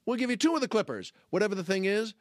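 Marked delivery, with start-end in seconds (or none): 0.1-1.1 high, excited infomercial pitch; hammed-up call-now announcer; TV commercial pitchman. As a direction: sell it like a TV offer that keeps getting better. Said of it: "what do they always say on T V".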